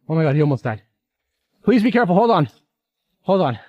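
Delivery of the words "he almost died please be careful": ordinary talk, nothing marked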